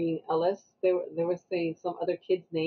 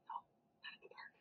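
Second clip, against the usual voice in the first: whispered warning, retold; hushed correction; 28 dB quieter, faster than usual. Mother to son repeating YouTube lines, hushed. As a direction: hush him under your breath.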